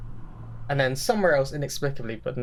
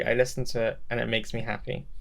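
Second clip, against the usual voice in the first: ordinary talk, nothing marked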